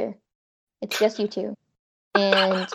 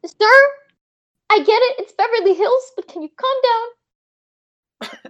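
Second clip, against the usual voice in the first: high, loud exasperated call to a revving car; mock yelling at driver; 12 semitones higher, 5 dB louder than usual. Yelling at a show-off sports car driver, annoyed. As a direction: shout up at the loud car, fed up.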